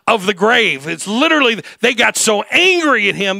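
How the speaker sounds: ringing pulpit voice; full-force preaching. Acted. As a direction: drive it home to the congregation.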